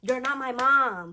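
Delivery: high, slow, loud angry retort; angry shout. Retold or imitated. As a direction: snap back harder this time. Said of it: "Tasha snaps on".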